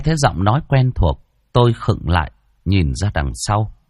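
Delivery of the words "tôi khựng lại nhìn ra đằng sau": usual speaking voice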